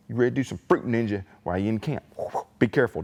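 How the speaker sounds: ordinary delivery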